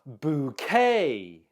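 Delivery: "Bouquet" with stress on the final -quet; second-syllable stress. Shown as a demonstration. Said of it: "The stress is on the second syllable".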